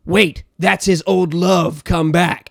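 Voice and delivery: milk the reveal like a soap twist; mock gasp reveal. Mocking a show's big twist, slow dramatic gasp. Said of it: "they're like".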